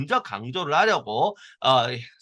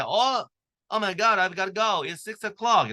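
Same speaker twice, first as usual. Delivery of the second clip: higher-pitched, mock alarm at the time; acted sudden realisation; 6 semitones higher, much slower than usual. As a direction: act out suddenly having to leave. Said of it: "나 가야 돼." 할 때".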